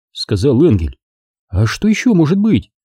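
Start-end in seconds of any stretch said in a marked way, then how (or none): 1.5-2.7 high-pitched hurt society lady; Mrs Kane, high and offended. A narrator's reading. Mrs Kane to Engel, high and injured. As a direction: she sounds wounded that no one thanks her.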